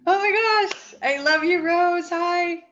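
gasp, then high, loud, gushing greeting; delighted surprise. Real, not acted. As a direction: squeal a real hello to a dear friend. Delighted at greeting from old friend.

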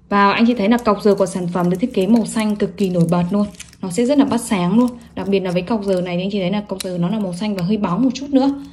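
usual speaking voice

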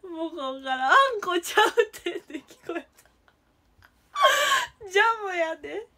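loud, high, breaking up with laughter; laughing protest. Real, not acted. Streamer mistaking jam for anko, laughing protest. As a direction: protest helplessly through big laughs. Jam correction shouted from off camera.

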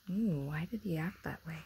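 hushed question whispered to the listeners; breathless whisper of wonder; whispered aside. Real, not acted. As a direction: drop to a secret whisper.

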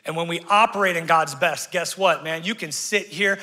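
usual speaking voice

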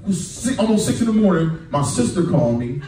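normal talking voice

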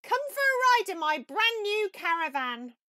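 high, loud show-off voice; boastful Toad. A narrator's reading. Toad invites Ratty, boasting in a high loud voice. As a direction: puff up proudly over the new caravan.

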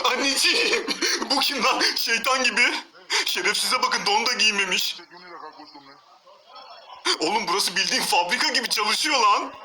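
loud, laughing disbelief; laughing shout. Real, not acted.

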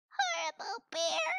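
very high squeaky voice on "Horrible bear"; squeaky bat. Acted. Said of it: "Bat squeaked".

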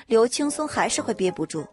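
ordinary talk

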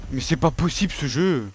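ordinary talk, nothing marked

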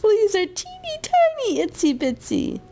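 very high, playful little voice. Real, not acted. Mother describing fleas to son, high tiny voice. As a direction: squeak it to sound tiny.

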